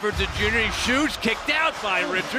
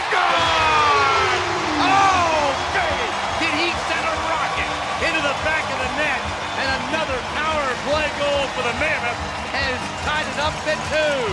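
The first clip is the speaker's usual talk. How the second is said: much higher and louder, bursting goal call; long goal yell; 9 semitones higher, 3 dB louder than usual. Real, not acted. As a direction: roar the goal, stretch it out. Goal scored on the power play.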